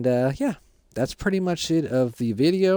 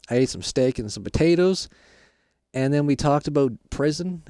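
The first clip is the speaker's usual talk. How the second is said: ordinary delivery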